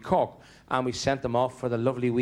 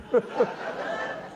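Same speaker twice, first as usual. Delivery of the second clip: giggle; 9 semitones higher than usual. Retold or imitated. Wife's giggle.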